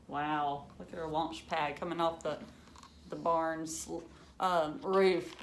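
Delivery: lively storybook reading for a class; reading to children. Acted. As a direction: read it like a picture book to kids. Teacher reads picture book aloud.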